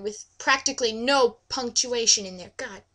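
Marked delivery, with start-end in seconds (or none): none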